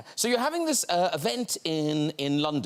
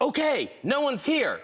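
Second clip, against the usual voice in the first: high, clear call to a toy; cueing the toy; 10 semitones higher than usual. Pretend. Host signals the toy all is clear, calling high.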